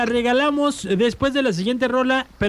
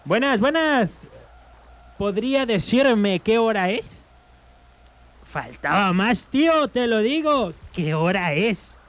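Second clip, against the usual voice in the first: slow comic Galician Spanish; joke-telling gallego accent, unhurried; 2 semitones lower, slower than usual. Acted. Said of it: "van otra vez dos gallegos".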